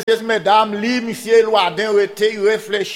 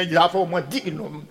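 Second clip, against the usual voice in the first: ordinary delivery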